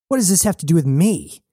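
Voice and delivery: quick, high, angry protest; building rage. A narrator's reading. Jazz fighting back at Knox, rage building. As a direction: fire it off high and fast in anger. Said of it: "Jazz felt the rage building".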